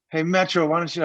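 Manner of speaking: ordinary talk, nothing marked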